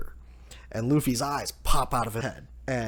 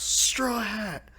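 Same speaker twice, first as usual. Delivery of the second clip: a hammed-up challenge called out high; mock yell; 11 semitones higher than usual. Kid arriving at the capital, calling out Luffy, mock yell. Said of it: "and he yells".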